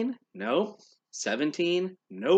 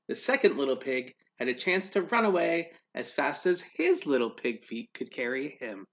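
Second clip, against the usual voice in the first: plain reading voice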